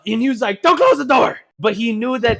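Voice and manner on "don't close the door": loud, high panicked yell; mock panic. Kid protesting the closing truck door, mock-panicked yell. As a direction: cry out in put-on alarm. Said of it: "he wasn't genuinely scared of the door being closed".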